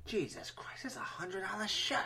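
hushed, breathy whisper of disbelief. Retold or imitated. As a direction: whisper it under your breath. Whispered movie quote.

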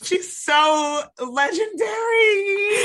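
thrilled half-shouted praise; delighted outcry. Real, not acted. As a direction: squeal it out like a thrilled fan. Delighted reaction to the tweet.

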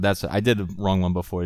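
ordinary delivery

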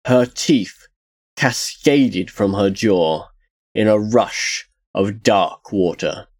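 ordinary delivery, nothing marked

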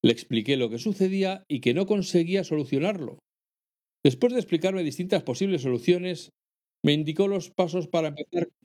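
reading a letter aloud. Acted. Reads listener's email.

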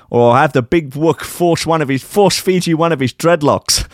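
high comic Dutch voice. Acted. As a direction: sneer like a smug villain. [name] voice again.

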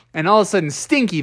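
plain reading voice

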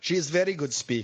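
put-on brisk broken English in a Russian accent; hammed-up Russian accent; mock Russian accent. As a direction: echo it in a mock Slavic accent.

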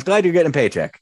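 ordinary delivery

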